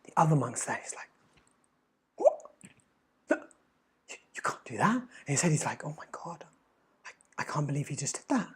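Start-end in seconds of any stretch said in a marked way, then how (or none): 2.2-5.0 hushed, scandalised whisper to the master; shocked whisper. Retold or imitated. Other monk whispers his shock at the master. 5.8-8.5 disbelieving mutter. Retold or imitated. Other monk mutters to himself.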